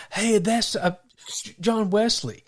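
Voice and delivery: high, lightly acted call of recognition; a hint of a hostile villager calling out; stranger spotting Wesley.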